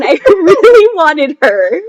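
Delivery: giggling fondly about the bunny; laughing. Real, not acted. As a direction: laugh through the admission. Laughs while describing bunny.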